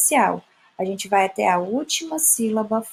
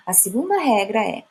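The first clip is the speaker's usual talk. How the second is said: usual speaking voice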